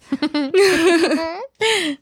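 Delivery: bursting out laughing. Real, not acted. Laughing at demon texts.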